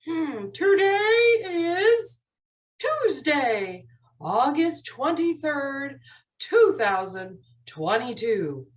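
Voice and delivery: slow, drawn-out date announcement; slow model recitation. Shown as a demonstration. Teacher shows helper how to say the calendar, slowly. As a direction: stretch out each part of the date with clear pauses. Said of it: "They're going to get to say this".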